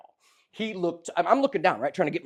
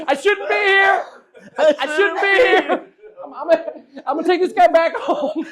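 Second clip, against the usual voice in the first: high, loud, laughing wail; laughing panic; 12 semitones higher, 10 dB louder than usual. Retold or imitated. Comedian retelling sliding backwards with a stranger, laughing panic. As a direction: cry it out through laughter.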